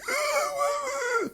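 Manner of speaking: a high, strangled choking gasp; choked gasp. Retold or imitated. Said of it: "it was not a choked, like".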